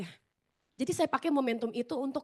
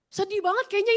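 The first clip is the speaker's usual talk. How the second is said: ordinary delivery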